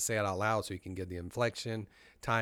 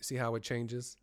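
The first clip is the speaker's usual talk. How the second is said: ordinary talk, nothing marked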